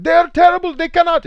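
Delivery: put-on high, loud yelling Indian accent. Parent yelling at kids on the other team, shrill Indian-accented shout. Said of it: "that's how he sounded".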